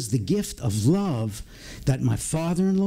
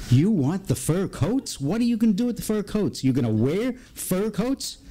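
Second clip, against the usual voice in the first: higher-pitched mock disbelief, rising at the end; incredulous questioning; 6 semitones higher than usual. Husband questions wife's wish to keep the fur coats, incredulous. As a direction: question it as if you can't believe the request. Acts himself questioning his wife.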